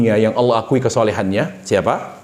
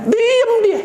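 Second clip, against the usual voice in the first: laughing. Real, not acted. Preacher laughs, voice jumps high.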